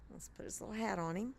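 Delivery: normal talking voice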